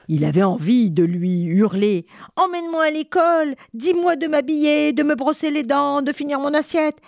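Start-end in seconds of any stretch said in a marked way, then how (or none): 2.4-7.0 put-on child pleading. Léo voudrait hurler.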